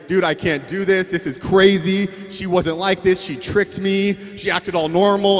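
hammed-up freaked-out husband complaining; mock despair of a newlywed guy on the phone; panicked husband. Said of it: "you're gonna say".

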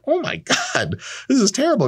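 mock horror at a bad answer; teen hearing grim news, mock horrified; dismayed outcry. Imagined teen reading bad news.

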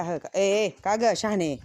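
loud sharp telling-off of a naughty goat; scolding a goat. Real, not acted. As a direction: snap at the bully goat. Scolding a goat butting others.

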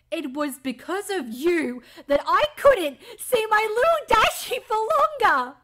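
loud, high-pitched outburst; anguished accusation. Acted. Pegasus blaming the killer, anguished accusation. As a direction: build to a high, loud cry of blame. Acted accusing character line.

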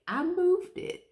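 "moved" pushed way up into a squeak; squeaky emphatic. Real, not acted. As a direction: repeat it high, almost a squeal. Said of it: "'cause I moved it".

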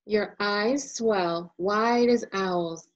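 plain reading voice

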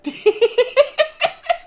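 high, loud laughing burst; helpless laughter. Real, not acted. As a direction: break down laughing. Cracks up at item name.

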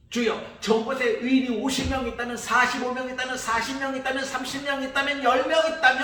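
urgent haggling climbing in pitch to a loud cry; pleading bargain. Acted. Abraham bargaining for the city. (아브라함의 점점 높아지는 간청)

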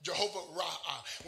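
Jehovah Ra'ah said slowly, each part clear; slow teaching pronunciation of Jehovah Ra'ah; careful pronunciation. Shown as a demonstration. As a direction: spell it out in sound, slowly. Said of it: "R A A H".

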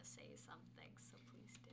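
hushed aside to a classmate; whispering. Real, not acted. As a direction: whisper it under your breath. Aside to classmate during presentation.

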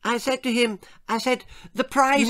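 high-pitched chatty lady's voice; trilling society lady. A narrator's reading. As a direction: carry on trilling, pitched right up. Lady de Trop carries on.

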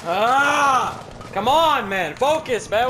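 loud, high exasperated shout; frustrated yell. Real, not acted. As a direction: yell at the useless team. Teammates failing, frustrated yelling.

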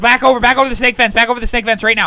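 commanding shout. Acted. Officer ordering his men back over the fence, shouting. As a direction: yell the retreat over the fence.

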